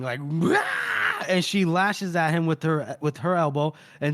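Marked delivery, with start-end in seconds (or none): 0.2-1.2 very high, strained mock scream. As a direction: imitate a raw scream, pitched way up. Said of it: "she just starts screaming".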